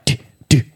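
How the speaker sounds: two short, separate "di" hits; imitated beat drop. Shown as a demonstration. Showing how it came out on stage, imitated beat. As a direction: mouth a hollow drop, beat by beat. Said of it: "Niin se tuli vaan näin".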